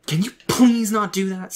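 high, loud angry protest. A narrator's reading. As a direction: snap it out loud and high, exasperated. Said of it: "Sam asked angrily".

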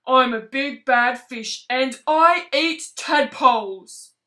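slow, loud booming villain; menacing slow boom; big bad fish voice. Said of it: "boomed the rubbery lipped creature".

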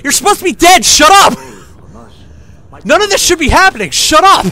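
high, loud "shut up" at the screen; yelling at the game guards to shut up; fed-up shout. Real, not acted. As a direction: blast them into silence. Yelling back at game chatter.

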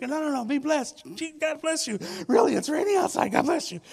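mock pleading, pushing the gift back; eager insisting. Preacher reenacts insisting the stranger keep his umbrella, excited and loud. Retold insisting to soaked man.

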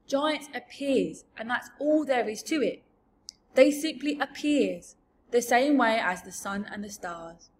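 loud, lilting giant storyteller voice; big rustic giant voice; matter-of-fact giant. Acted. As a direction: explain it loudly as an odd giant. BFG explains how giants appear.

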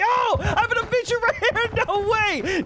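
high-pitched yelp with laughter; excited disbelief. Real, not acted. Taunt turns to disbelief.

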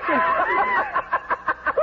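high, eerie cackle from the kitchen; an unseen thing laughing wildly; terrible laughter. Acted. Ghostly presence laughing in the kitchen, eerie high cackle. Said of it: "It's laughing, terrible laughing".

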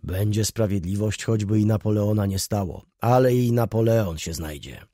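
ordinary delivery, nothing marked

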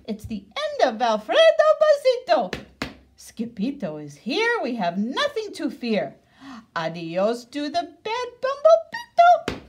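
high, loud, joyful; excited cheer. A narrator's reading. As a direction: cheer like a happy pup. Dogs cheering wildly.

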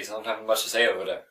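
usual speaking voice

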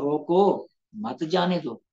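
"रोको" held, break, then "मत जाने दो"; pause after रोको. Shown as a demonstration. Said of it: "हुक्म अक्स हो गया है".

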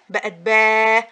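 "با" drawn out loud and slow; lengthened vowel. Shown as a demonstration. Teacher showing baa stretched by madd, long vowel. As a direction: hold the long A in "با". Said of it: "مدينا".

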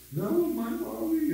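high, slow mock whine of a little girl; whining child. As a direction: imitate a small child whimpering for mommy. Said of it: "Emma was kind of whining".

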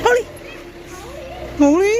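sharp high call. Real, not acted. Calling slow down.

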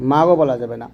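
ordinary delivery

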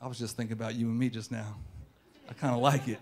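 soft, low sweet talk; kicking game. Acted. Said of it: "as I'm kicking game again".

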